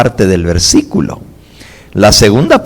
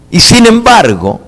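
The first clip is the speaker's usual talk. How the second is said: emphatic preaching; 12 semitones higher, 5 dB louder than usual. Shown as a demonstration. Preacher repeats the point with force.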